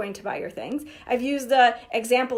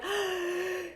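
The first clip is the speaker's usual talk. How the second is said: sharp mock gasp of delight, higher; excited gasp; 7 semitones higher, 7 dB quieter than usual. Coach mimics a seller thrilled by a new lead, gasping. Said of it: "getting all excited".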